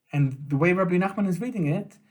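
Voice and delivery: ordinary delivery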